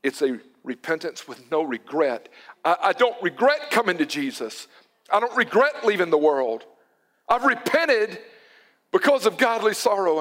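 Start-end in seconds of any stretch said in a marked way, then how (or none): 2.6-10.2 higher and louder, declaring it boldly; rising conviction. Real, not acted. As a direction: proclaim it with fervour. Preaching rises to conviction.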